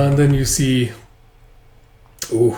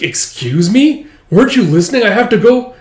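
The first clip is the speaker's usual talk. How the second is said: put-on girl yelling at Tao, higher and louder; indignant shouting; 9 semitones higher, 6 dB louder than usual. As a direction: demand he let her go. Arguing with Tao.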